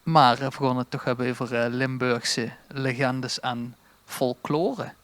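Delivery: ordinary talk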